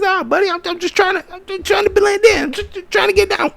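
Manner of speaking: way up high, silly and whiny, half shouting; high goofy Adam Cole voice. Retold or imitated. Adam Cole on Twitch answering MJF, in a squeaky high mock voice. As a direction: play him as a squeaky, flustered gamer brushing off the call.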